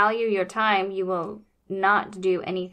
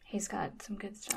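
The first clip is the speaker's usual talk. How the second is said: unvoiced whispered aside; 15 dB quieter than usual. Real, not acted. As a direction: whisper it as a secret.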